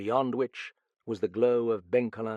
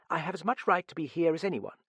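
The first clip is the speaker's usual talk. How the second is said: put-on high, quick young woman's retort; defiant girl; 7 semitones higher, faster than usual. A narrator's reading. Girl claiming her right to stay, defiant. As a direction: stand your ground in a rush.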